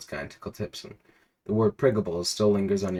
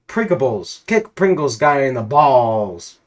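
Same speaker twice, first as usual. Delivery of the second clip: forced loud yell; 4 semitones higher, 9 dB louder, slower than usual. Acted. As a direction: shout it, slow and much louder. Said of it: "force out the words".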